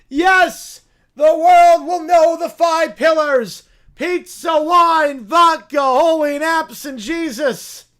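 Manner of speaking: high, loud zealot sermon to the rats; rally the rodents like a mad prophet; preaching bear. Acted. Bear proclaims pillars.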